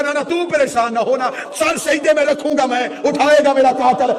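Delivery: high, loud lament for a weeping figure; retold tearful plea. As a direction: voice his tearful vow at full pitch. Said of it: "رو کے کہا".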